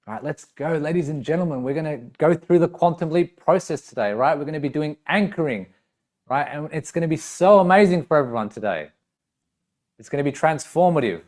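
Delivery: upbeat, energetic seminar host; enthusiastic motivator. Shown as a demonstration. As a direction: hype up the room like a motivational speaker. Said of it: "the tone is more enthusiastic".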